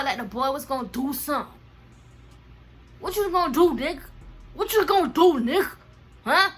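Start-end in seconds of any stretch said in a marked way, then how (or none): 3.0-6.5 loud, high taunting challenge; retold face-off, pitched up and loud; confrontational challenge. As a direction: call him out, raised voice. Replaying confrontation with the boy.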